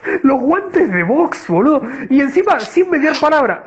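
laughing while shouting it out; laughing excitedly. Real, not acted. Laughing, excited about boxing gloves.

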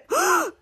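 sharp high gasp; a quick excited intake of breath. Acted. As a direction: gasp in delight. Kids gasp at jeans.

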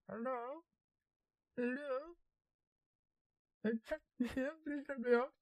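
high tiny voice trapped in a can; voice in a beer can. Pretend. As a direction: sound like a person locked in a can. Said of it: "doing the voice into the beer can".

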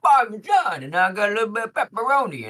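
bouncy comic spiel; joke man. Shown as a demonstration. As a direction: oversell the toppings like a goofy host. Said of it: "the joke man and also the straight man".

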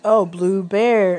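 drawn-out, syllable by syllable "blue bear"; slow sounding out. Retold or imitated. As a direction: stretch "blue bear" slowly like reading it aloud. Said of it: "So I'm like".

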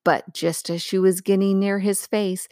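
ordinary delivery, nothing marked

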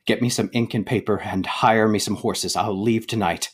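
ordinary delivery

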